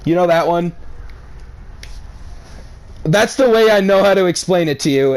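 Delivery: ordinary delivery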